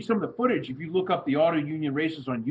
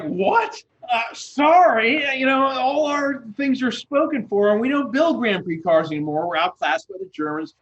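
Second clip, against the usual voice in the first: lightly voiced flustered Bugatti, higher and louder, laughing; 9 semitones higher, 5 dB louder than usual. Author voices Bugatti turning down the heroine, lightly flustered and higher. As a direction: play a startled old carmaker brushing her off.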